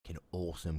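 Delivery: ordinary talk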